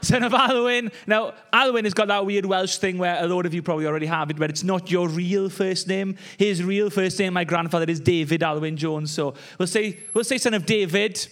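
ordinary talk

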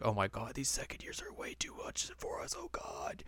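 breathless, unvoiced whisper of tired students; exhausted whisper. Host voicing exhausted students after training, breathless whisper. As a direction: gasp it out, worn out from training. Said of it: "very out of breath".

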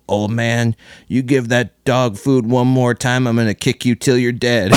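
a hint of a scolding mother threatening the old man; Olympia Dukakis quote. Toddler quoting a Moonstruck scene, mild stern mother voice. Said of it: "Quoting fucking, uh, Olympia Dukakis".

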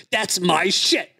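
hammed-up outrage over his package; raging dad. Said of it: "the way my dad was explaining it".

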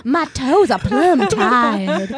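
slow, loud, laughing diva drawl; camp diva voice. Retold or imitated. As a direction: ham it up like a pampered starlet. Camp Jesus impression.

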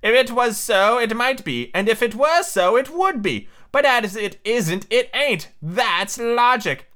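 high, emphatic storybook twin; high-voiced, deliberate little logician; pedantic Tweedledee. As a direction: reason it out high, landing slowly at the end.

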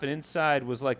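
ordinary talk

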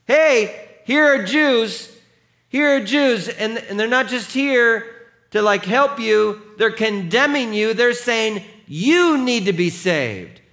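put-on high, loud demon cry through a little girl; screaming out. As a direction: shriek it at the passing crowd. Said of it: "is screaming out".

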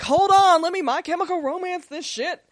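high, mock emo declaration; hammed-up emo outburst, high and insistent; dramatic emo girl.

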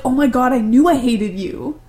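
hammed-up snarling Krennic; mock villain. Krennic voiced mocking grown-up Jyn.

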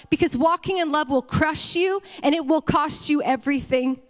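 impassioned, forceful sermon delivery; full-force preaching. Real, not acted. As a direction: proclaim it over the whole church.

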